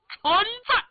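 high, loud, ringing reply; official answering summons. A narrator's reading. Prince answers "present" to the emperor, high loud shout.

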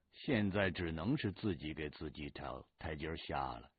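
ordinary delivery, nothing marked

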